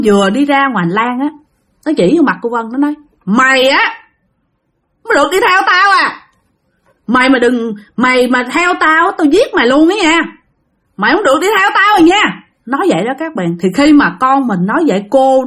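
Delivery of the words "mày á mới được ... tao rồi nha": put-on high, fast threatening child voice; menacing possessed boy. Possessed son threatens his mother, high menacing voice. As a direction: snarl the threat high and quick like a possessed kid.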